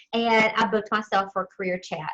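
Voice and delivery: ordinary delivery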